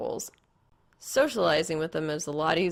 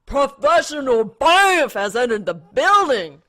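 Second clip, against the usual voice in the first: slow, booming, higher proclamation; hammed-up announcer call; loud announcement; 7 semitones higher, 13 dB louder than usual. A narrator's reading. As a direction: herald a grand entrance. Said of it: "Blake announces to the entire diner".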